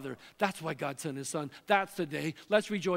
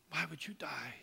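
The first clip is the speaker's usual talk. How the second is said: whispered, softer plea; hushed heartbroken question; 3 semitones lower, 10 dB quieter than usual. Retold or imitated. Quiet end of God's plea.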